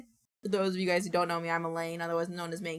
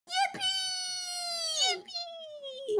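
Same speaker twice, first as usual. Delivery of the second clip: squeaky yippee; 3 dB quieter, much slower than usual. Acted. A clip being played.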